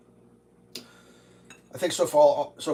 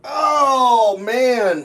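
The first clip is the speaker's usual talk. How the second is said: straining cry at a stuck lid; opening a stuck jar; 11 semitones higher, 10 dB louder than usual. Real, not acted. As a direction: grunt it out while twisting a lid. Struggling with stuck syrup lid.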